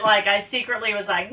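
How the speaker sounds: ordinary talk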